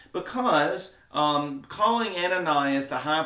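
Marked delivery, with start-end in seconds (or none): none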